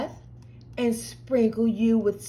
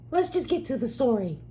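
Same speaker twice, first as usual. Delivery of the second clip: ordinary delivery, nothing marked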